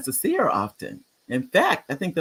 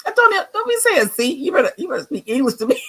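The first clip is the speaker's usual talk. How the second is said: high-pitched, loud playful telling-off; teasing, squealed warning; mock scolding; 12 semitones higher, 4 dB louder than usual. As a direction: pretend to scold a friend, on the edge of laughter. Playfully scolding a commenter's Spanish.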